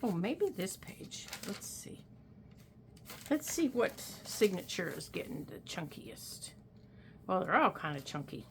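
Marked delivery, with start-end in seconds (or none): 1.5-6.5 whispering. Real, not acted. Muttering while searching pages.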